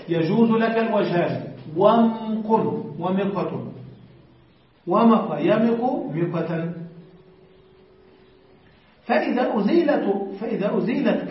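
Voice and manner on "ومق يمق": usual speaking voice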